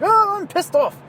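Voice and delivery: high, mock whiny complaint; high-pitched, hammed-up hobbit whine; whiny mock Bilbo. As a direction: squeal it like a sulking hobbit. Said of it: "And he's like".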